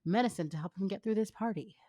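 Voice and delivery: ordinary talk, nothing marked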